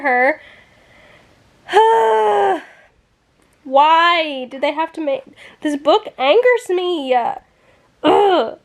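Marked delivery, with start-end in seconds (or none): 1.7-2.6 loud exasperated sigh. Real, not acted. Frustrated by the book. 8.0-8.6 disgusted ugh. Real, not acted. Angry at the book.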